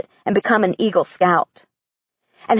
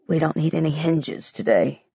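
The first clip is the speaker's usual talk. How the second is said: softer, lower, subdued refusal; quiet; 5 semitones lower, 6 dB quieter than usual. Acted. Grieving dad quietly refusing his daughter in the store. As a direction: say it gently, deflated. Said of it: "Dad says quietly".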